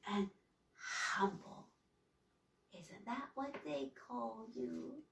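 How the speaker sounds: soft, hushed witchy rasp; half-whispered hag voice; raspy voice. A narrator's reading. Raspy woman voice continues.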